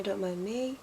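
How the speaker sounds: ordinary delivery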